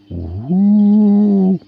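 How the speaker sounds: high, loud, long ghost wail; ghostly wail. A narrator's reading. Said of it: "wailed the ghosts".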